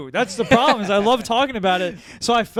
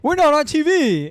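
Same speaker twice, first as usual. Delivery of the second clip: higher-pitched mock complaint; mock gripe; 9 semitones higher, 3 dB louder than usual. Podcaster voicing minorities' shallow gripes, mock complaint. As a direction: whine it as a joke grievance.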